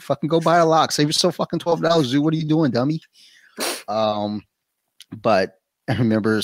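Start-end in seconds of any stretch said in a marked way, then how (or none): 0.0-3.0 mock self-scolding. Mocking himself as bad salesman.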